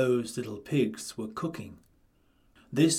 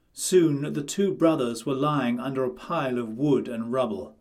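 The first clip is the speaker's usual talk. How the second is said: plain reading voice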